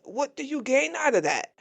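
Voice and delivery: usual speaking voice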